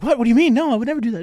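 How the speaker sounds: very high, squeaky indignant denial; defensive protest. Retold or imitated. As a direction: protest your innocence in a high whine. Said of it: "He's like".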